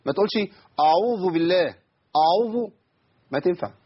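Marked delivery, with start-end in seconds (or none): 0.8-2.7 أعوذ with a thick, dark opening a; heavy hamza. Shown as a demonstration. Teacher shows what to avoid, heavy hamza in أعوذ. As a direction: make the first sound of أعوذ heavy. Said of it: "ما تفخمش الهمزة".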